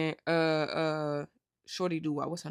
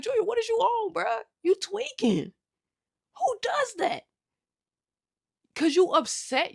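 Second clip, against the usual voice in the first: much higher, exasperated voice; disbelieving outburst; 13 semitones higher than usual. Real, not acted. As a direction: scold Junior as if he's right there. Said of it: "I'm on the same type of time".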